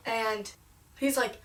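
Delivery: normal talking voice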